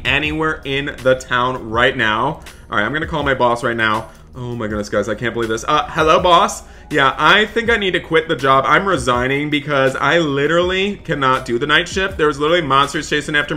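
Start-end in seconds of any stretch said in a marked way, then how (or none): none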